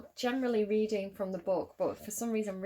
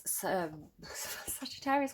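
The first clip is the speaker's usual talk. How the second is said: slow, breathy giggling whisper of a misspoken word; laughing whisper; 5 dB quieter, slower than usual. Real, not acted. Tarot reader giggles at her own slip, whispering. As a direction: giggle it out under your breath.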